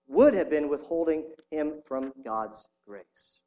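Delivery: emphatic testimony reading; story reading. Acted. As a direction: read with building conviction.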